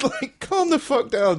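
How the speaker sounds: squeaky, laughing plea to calm down; high mock exasperation. Mocking the outraged public, high and exasperated. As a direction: pitch it way up, exasperated.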